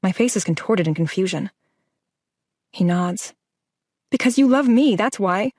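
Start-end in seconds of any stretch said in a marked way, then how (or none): 4.1-5.5 a raised yell, higher and louder. A narrator's reading. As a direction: blurt it out louder than you should. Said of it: "I yell, louder than I should".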